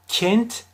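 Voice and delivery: kent with an overly closed e. Shown as a demonstration. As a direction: close the e of kent too much. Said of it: "kapatırsam çok fazla yanlış olur". (kapalı e ile yanlış kent)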